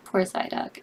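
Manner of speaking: soft whisper of sympathy; whispered pity. Real, not acted. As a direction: breathe it out in grief. Mourning favorite character.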